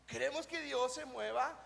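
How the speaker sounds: normal talking voice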